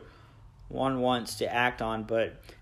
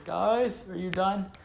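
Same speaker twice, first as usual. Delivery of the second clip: calling out; 7 semitones higher, slower than usual. Retold or imitated. Calling again walking upstairs.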